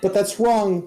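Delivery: high, falling repeat of the denial; agitated correction. Acted. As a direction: insist again it's wrong.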